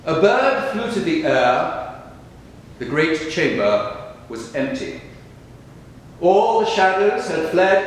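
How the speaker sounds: solemn, spacious verse delivery; poetry recitation. Acted. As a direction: declaim each line deliberately, letting the phrases hang. Reads own poems aloud after the talk.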